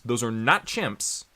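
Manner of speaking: ordinary talk, nothing marked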